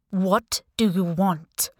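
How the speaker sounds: slow, demanding ruler; put-on imperious voice, slowed down; defiant Sultan. A narrator's reading. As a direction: demand an answer, word by word. Captive Sultan challenges caller.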